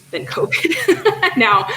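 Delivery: slow words broken by laughter; laughing. Real, not acted. Host laughs about what this year has been. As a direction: laugh your way through it.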